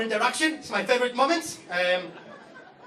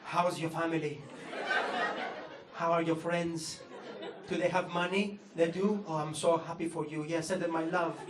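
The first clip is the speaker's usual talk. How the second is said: softer, gushing sympathetic voice; mock tender concern; 6 semitones lower, 7 dB quieter than usual. Polite British strangers fussing over a Greek comic, softly mock tender. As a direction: coo over him like a worried aunt.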